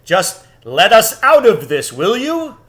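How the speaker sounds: loud, firm lion call; commanding lion. A narrator's reading. Aslan calling up to the giant, loud and firm. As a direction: address the giant with authority. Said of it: "said Aslan".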